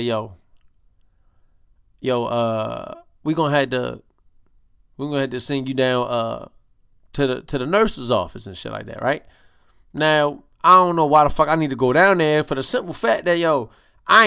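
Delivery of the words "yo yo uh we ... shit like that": low and quiet, like sharing bad news; hushed confiding voice. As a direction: lean in and keep it down. Coworker quietly breaks the news.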